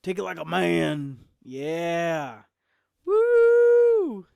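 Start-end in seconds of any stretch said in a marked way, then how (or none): 3.1-4.2 high, loud party whoop; loud woo. Real, not acted. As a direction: whoop like a cheering fan. Cheering the chug.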